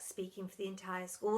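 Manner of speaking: ordinary delivery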